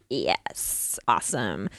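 very low, soft private celebration; secret relief. Retold or imitated. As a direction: savour it quietly under your breath. Secret relief at cancelled meeting.

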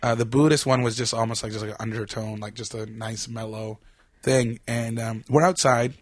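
ordinary talk, nothing marked

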